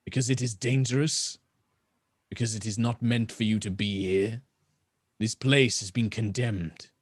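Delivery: lightly voiced rasping elf. Elf explains, slips on word.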